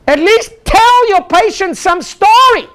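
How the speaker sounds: high, slow pleading aside; half-joking exasperation; exasperated plea. Real, not acted. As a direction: beg them to tell a story.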